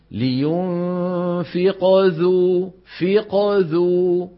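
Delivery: very slow لينفق ذو with a light ق bounce flowing into ذو; slowed فق ذو, ق linked lightly; minor qalqala in connection. Shown as a demonstration. Teacher demonstrating connected qalqala to the class. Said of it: "فلو وصلت تكون صغرى".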